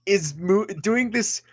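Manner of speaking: normal talking voice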